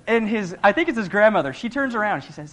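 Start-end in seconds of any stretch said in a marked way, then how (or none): none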